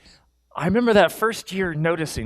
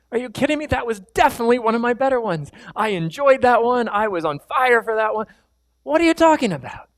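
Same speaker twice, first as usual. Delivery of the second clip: high, fast protest; indignant disbelief; 6 semitones higher than usual. Retold or imitated. Pastor reliving indignation at his wife's verdict. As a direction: insist it was great.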